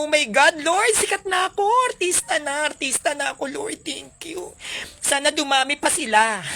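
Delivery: high, exaggerated delight at being famous; mock gleeful thanks. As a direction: gush with thanks like a starstruck celeb. (kunwaring tuwang-tuwang pasasalamat)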